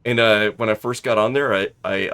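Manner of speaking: ordinary talk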